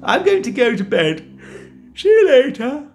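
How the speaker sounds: high, bored wife voice; giant's wife. Acted. Giant's wife humouring her husband in a high voice. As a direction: play the wife high and dismissive.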